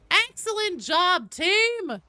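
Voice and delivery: slow, squealing over-the-top cheer; bubbly sorority girl. Acted. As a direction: gush like a peppy cheerleader. Cheering the squad again.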